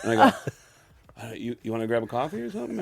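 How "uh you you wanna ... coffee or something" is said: quick, soft, stumbling invitation; timid asking. Retold or imitated. New voice actor retrying an invitation to his boss, timidly. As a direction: trail it out quietly and hesitantly.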